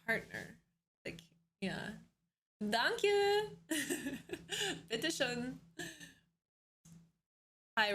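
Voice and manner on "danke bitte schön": put-on German accent. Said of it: "my Deutsch accent".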